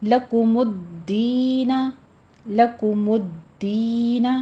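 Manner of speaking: slow لَكُمُ الدِّينَ with the یا stretched; tajweed demo. Shown as a demonstration. Teacher recites slowly, twice. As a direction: pull the ی of الدِّينَ long. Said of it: "یا مدہ کھینچ کے پڑھنی ہے".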